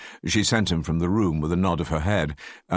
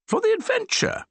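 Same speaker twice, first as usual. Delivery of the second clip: very high, playful lilt; merry. A narrator's reading. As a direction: toss it off merrily. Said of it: "said merrily".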